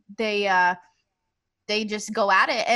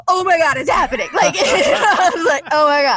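loud, high, crying with joy; overwhelmed happy shout; tearful excitement; 6 semitones higher, 5 dB louder than usual. Retold or imitated. Retold proposal surprise, crying.

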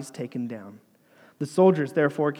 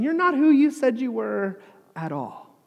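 high, louder retold accusation; accusing crowd; 9 semitones higher, 5 dB louder than usual. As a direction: throw it at him like a jeering crowd. Hostile crowd accuses Jesus.

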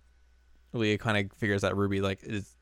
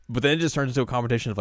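normal talking voice

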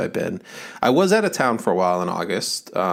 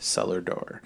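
savoring phrase; 3 semitones lower, 8 dB quieter, slower than usual. Acted. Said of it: "the most beautiful phrase in the English language".

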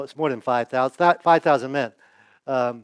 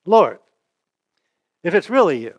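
calling out; 5 dB louder than usual. Retold or imitated. Peter calling to Jesus from the boat, mild mock call. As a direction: call across the water to him. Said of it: "Peter calls out to him".

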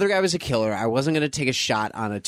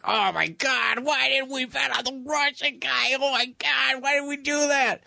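much higher, whiny mock panic; 12 semitones higher than usual. Friends panicking over their bet on the Russian, high whiny mock dismay. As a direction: wail like bettors regretting their pick. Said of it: "they're like".

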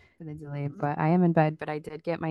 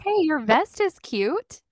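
loud, high, slow singsong call; high-pitched compliment; 12 semitones higher, 7 dB louder, slower than usual. Real, not acted. Co-host calls to friend's husband in vest, high-pitched. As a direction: coo it loudly way up high.

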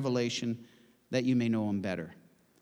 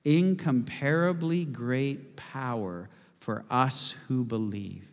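slow congregational recital; slow, weighty repeat; slower than usual. Shown as a demonstration. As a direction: lead the room slowly. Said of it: "Can you say those words with me".

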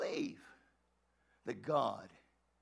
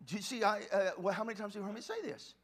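ordinary delivery

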